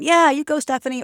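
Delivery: high, loud cheering whoop. Retold or imitated. Friends cheering Stephanie on, high excited whoop. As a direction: cheer her on like a friend in the crowd. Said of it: "they're cheering it on".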